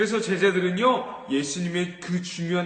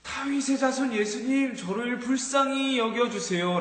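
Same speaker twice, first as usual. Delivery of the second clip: crying out; 7 semitones higher than usual. Acted. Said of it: "라고 소리치기 시작했던 것이에요".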